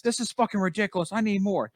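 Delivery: high-pitched mock outrage of whiny demand; pissed-off fans. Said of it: "they would. They'd get pissed".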